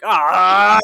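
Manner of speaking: loud, high, sudden yell; startled yell. Retold or imitated. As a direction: jolt awake with a shout. Waking from pee dream.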